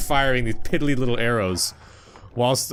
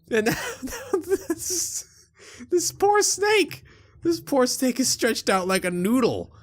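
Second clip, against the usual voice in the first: laughing; 11 semitones higher, slower than usual. Real, not acted. Laughing at the stretched snake.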